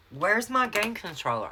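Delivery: loud, high-pitched indignant question; demanding husband. Retold or imitated. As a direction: imitate a husband demanding an answer. Husband demands his hidden controller.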